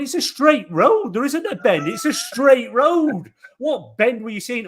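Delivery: telling off the driver who hit his car, exasperated; indignant retort. Retold or imitated. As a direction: throw it back at her, fed up. Retold argument with old driver.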